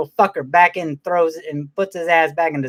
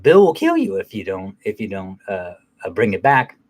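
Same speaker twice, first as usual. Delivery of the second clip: sly clown; 6 semitones lower than usual. Retold or imitated. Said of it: "he's like".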